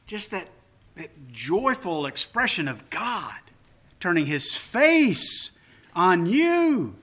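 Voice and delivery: ordinary talk, nothing marked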